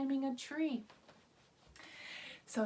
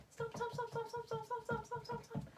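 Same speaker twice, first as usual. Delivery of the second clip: higher, much softer, quick and light; light and fast; 9 semitones higher, 9 dB quieter, faster than usual. Shown as a demonstration. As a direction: patter like tiny feet. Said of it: "Let's do it light and fast".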